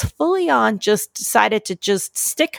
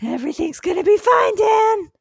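shouting; 10 semitones higher than usual. Acted. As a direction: shout it out cheerily. Shouted reassurance.

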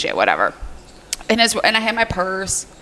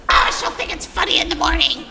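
1 freaky voice; 7 semitones higher than usual. Retold or imitated. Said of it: "the guy had a freaky voice".

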